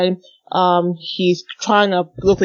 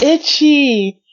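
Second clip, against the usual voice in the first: high, raised exclamation; acted outcry; 8 semitones higher, 4 dB louder than usual. Reader voicing the villain's itch, acted outcry. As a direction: burst out with a mock cry.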